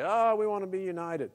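high mocking sweetness. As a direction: parody their unity slogan. Mocking the unity crowd.

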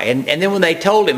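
normal talking voice

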